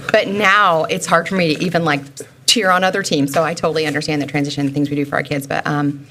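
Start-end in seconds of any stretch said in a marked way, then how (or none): none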